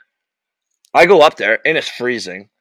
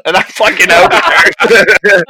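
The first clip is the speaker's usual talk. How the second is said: higher and louder, trailing up; loud triumphant exit; 6 semitones higher, 7 dB louder than usual. Retold or imitated. Player tells his friend how he left the rink, loud. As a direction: shout it as you walk out.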